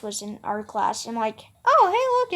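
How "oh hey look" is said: surprised. Retold or imitated. Spots Mister Thomas in class.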